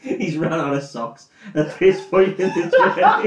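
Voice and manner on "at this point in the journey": laughing. Real, not acted. Laughing at own joke.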